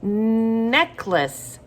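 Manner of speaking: Necklace starting on a held "nnn"; drawn-out first sound. Shown as a demonstration. Teacher confirming the letter for children, long N in Necklace. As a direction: stretch the N, then finish Necklace. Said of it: "makes that N sound".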